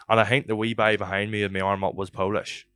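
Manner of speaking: usual speaking voice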